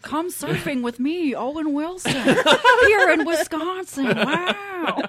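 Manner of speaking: mock Owen Wilson ad voice.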